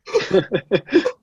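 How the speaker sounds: a high burst of laughter. Real, not acted. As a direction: laugh it off, amused. Laughing at Ajan's reaction.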